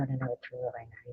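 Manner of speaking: ordinary talk, nothing marked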